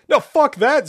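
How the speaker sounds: high, loud protesting yell; shout. Acted. Zack refuses to be shot, shouting. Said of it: "Zack shouted".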